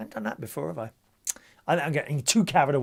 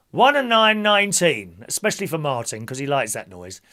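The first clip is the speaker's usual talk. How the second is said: bingo caller; 4 semitones higher, 5 dB louder than usual. Acted. Calls bingo numbers.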